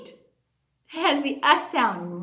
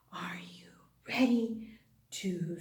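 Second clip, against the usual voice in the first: whisper; 4 semitones lower, 11 dB quieter than usual. Shown as a demonstration. Teacher whispering to her class to build suspense. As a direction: lean in and whisper the question. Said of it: "teacher whispers to children".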